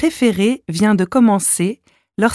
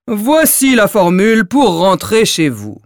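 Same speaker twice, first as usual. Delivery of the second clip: dim giant; 3 dB louder than usual. Acted. Giant E continues.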